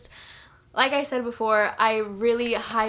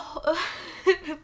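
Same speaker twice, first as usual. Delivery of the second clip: crying laugh; 6 semitones higher than usual. Real, not acted. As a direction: laugh catching on tears.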